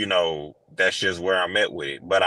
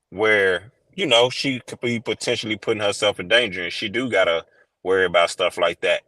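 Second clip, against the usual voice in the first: ordinary talk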